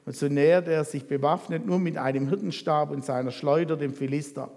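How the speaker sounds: usual speaking voice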